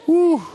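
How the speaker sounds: short, loud high "woo"; high mock wail. Kid stuck in crying, high mock wail. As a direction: imitate a crying kid's hoot.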